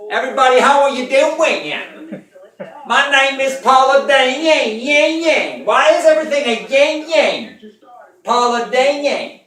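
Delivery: high, loud nasal Southern cooking-show voice; a Southern TV cook greeting her fans; Paula Deen impression. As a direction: ham up Paula Deen talking through her nose. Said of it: "That was my Paula Deen".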